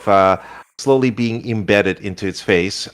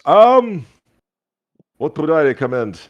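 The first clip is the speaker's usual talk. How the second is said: goo shopkeeper voice; 8 semitones higher, 3 dB louder than usual. Acted. Goo shopkeeper welcoming a lady to his store, high and showy. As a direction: sell it like a pompous jelly shopkeeper. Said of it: "He goes".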